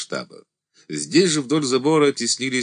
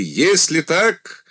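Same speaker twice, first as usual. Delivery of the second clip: commanding; 10 semitones higher, 4 dB louder than usual. A narrator's reading. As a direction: announce it to the ranks. Commander addressing regiment.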